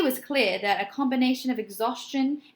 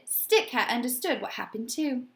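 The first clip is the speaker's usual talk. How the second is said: usual speaking voice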